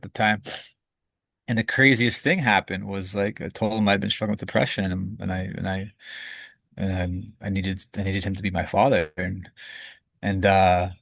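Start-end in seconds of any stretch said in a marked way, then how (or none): 4.9-10.9 real welling tears. Real, not acted. Telling the host about his father, near tears. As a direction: choke on it, then push through. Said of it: "I can feel tears kinda coming up".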